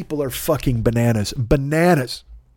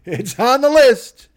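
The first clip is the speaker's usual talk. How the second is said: high, slow goofy voice; silly sing-song; 10 semitones higher, 3 dB louder, slower than usual. Pretend. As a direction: stretch it out playfully. Laughing, repeating the promise.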